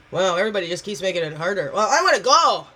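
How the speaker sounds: hammed-up exasperated outburst; mock complaint. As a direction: act out being fed up, bursting out at the end. Acts out fed-up victim thought.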